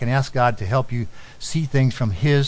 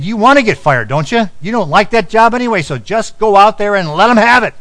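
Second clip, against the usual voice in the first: loud and higher, pushing hard; fired-up urging; 9 semitones higher, 7 dB louder than usual. Retold or imitated. Uncle on the phone urging Mark to risk getting fired, loud and fired up. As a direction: egg him on to go let them have it.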